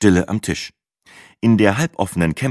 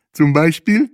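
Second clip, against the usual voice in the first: interested; 10 semitones higher, 5 dB louder than usual. A narrator's reading. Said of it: "fragte Bahe interessiert".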